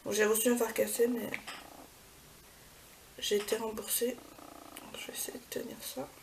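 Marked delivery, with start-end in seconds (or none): none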